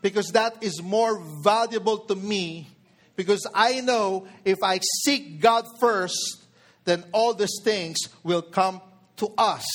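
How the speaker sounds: usual speaking voice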